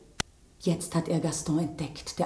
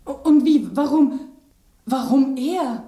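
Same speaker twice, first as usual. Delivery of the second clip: high, loud shocked questions; loud high bewildered outburst; shocked outcry; 7 semitones higher, 8 dB louder than usual. Acted. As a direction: cry out in disbelief. Shocked outcry after the victim dies.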